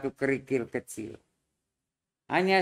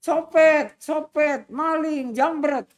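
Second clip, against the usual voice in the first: high, loud cries of thief; robbed woman calling the crowd, shouting; shouting for help; 12 semitones higher, 5 dB louder, slower than usual. Retold or imitated. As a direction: yell it out to the street, alarmed. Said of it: "Dan ini teriak-teriak".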